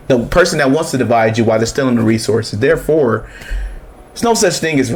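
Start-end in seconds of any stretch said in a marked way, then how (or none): none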